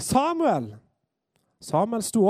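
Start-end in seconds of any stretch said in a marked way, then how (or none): 0.0-0.7 high drawn call of a name; summoning call, falling at the end. Acted. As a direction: call him from afar, pitched well up. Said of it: "Herren ropte enda en gang".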